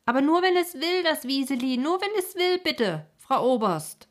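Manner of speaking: loud, high, anxious plea; anxious call. A narrator's reading. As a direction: call after someone, pleading and worried. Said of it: "rief er ihr noch einmal ängstlich nach". (ängstlich und laut nachrufend)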